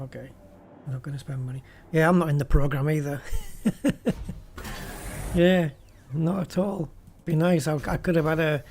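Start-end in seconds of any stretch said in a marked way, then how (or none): 3.3-5.3 high laugh. Real, not acted. Laughs at not being in program.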